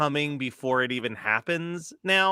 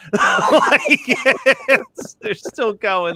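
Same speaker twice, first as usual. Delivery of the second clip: laughing; 8 semitones higher, 6 dB louder, much slower than usual. Real, not acted. Laughing at own softness.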